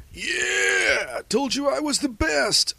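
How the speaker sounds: very high, triumphant shout; gloating. A narrator's reading. Girl bragging she is the best, gloating loudly. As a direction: crow about winning in a high squealed cheer. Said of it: "Asuka gloated".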